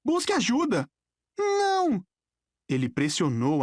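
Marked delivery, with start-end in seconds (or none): 0.0-0.9 high, urgent cry for action; acted shout without full volume; shouted order. A narrator's reading. 1.4-2.0 put-on high, drawn boy's refusal; distressed high-pitched outcry; anguished cry. A narrator's reading. Nico cries out, covering his ears.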